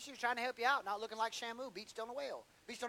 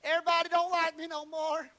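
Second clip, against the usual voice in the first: high, loud, whining complaint to the pastor; hammed-up sulky caller begging the pastor; whiny church member; 8 semitones higher, 5 dB louder than usual. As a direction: congregant whining to the pastor over petty slights, high and loud. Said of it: "He didn't call and say".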